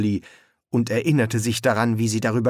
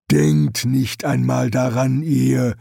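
slow, louder character warning; threatening warning; 3 semitones higher, 4 dB louder, slower than usual. A narrator's reading. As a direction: warn them off, word by word. Jade warns soldiers, raising her tree.

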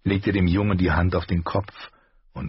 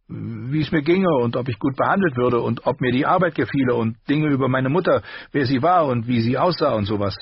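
put-on higher voice for the young apprentice; Gendry's voice; 7 semitones higher, 3 dB louder than usual. A narrator's reading. Apprentice telling Ned of the questions, higher voice. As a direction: pitch up, keep it eager.

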